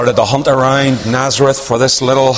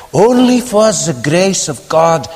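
broken English; 6 semitones higher, slower than usual. Retold or imitated. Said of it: "He had broken English".

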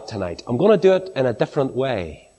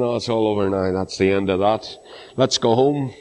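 mock downcast; 4 semitones lower than usual. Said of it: "with their faces down and saying".

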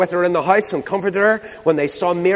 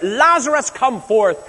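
high, ringing call; shouted command; 6 semitones higher than usual. Acted. Jesus at the grave calling Lazarus out, shouting. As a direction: raise the pitch sharply and summon him. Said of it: "he went to the grave and shouted".

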